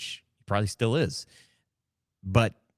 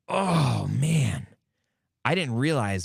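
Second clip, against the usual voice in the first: excited realization; 5 semitones higher, 3 dB louder, much slower than usual. Retold or imitated. Retold moment of sudden excitement.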